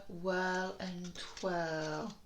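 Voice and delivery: whirl and twirl drawn out slowly, each sound stretched. Shown as a demonstration. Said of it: "This one's tricky".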